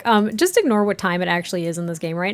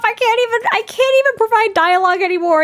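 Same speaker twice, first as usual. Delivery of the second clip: high, loud exasperated complaint; giggly exasperation, pitched up; 10 semitones higher, 5 dB louder than usual. Real, not acted. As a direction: throw up your hands at the game.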